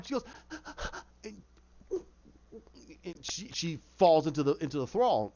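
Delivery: normal talking voice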